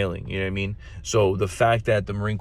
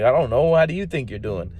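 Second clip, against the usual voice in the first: retold cheeky retort, higher and louder; sassy comeback; 5 semitones higher, 4 dB louder than usual. Recruiter turning the question back on the master sergeant, cheeky and raised. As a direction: toss the question back with mock sass. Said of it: "So I told him how he was doing".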